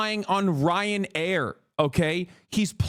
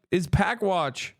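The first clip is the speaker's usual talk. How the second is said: ordinary talk, nothing marked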